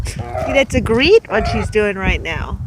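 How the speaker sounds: ordinary delivery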